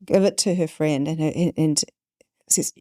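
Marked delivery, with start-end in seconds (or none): none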